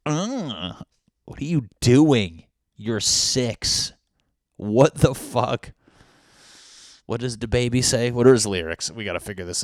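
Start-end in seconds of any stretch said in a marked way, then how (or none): 0.0-5.7 louder, slow mock-outraged girl; mock girl reaction. Girl reacting to the rapper, loud mock outrage. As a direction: play the shocked girl in the video. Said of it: "Every girl goes".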